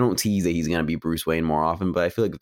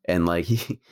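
ordinary talk

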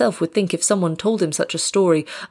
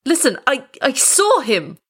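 high, agitated cry; voice breaking; 7 semitones higher than usual. A narrator's reading. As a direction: stumble into a desperate plea. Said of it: "The sudden break in his voice".

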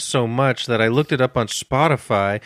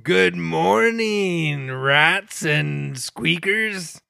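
slow, drawn-out radio DJ greeting; morning zoo DJ; 4 semitones higher, much slower than usual. Acted. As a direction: ham it up like a morning DJ. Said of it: "You sound like a morning zoo D J".

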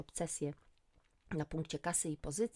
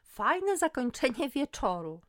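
higher, louder, drawn-out mocking echo; scornful snort; 8 semitones higher, 7 dB louder, slower than usual. A narrator's reading. Friend mocks Magda's idea of ending the night, scornful. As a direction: throw it back slowly, scoffing. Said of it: "parsknęłam".

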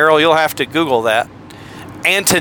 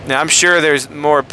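ordinary delivery

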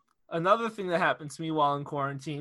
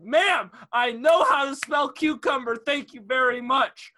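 exasperated retort; 6 semitones higher, 5 dB louder than usual. Retold or imitated. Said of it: "And I was like".

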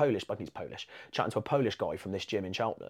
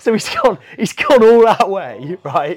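much higher and louder, slow and laughing; amused disbelief; 8 semitones higher, 16 dB louder than usual. Real, not acted. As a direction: marvel at it through laughter. Laughing at the Cyborg story.

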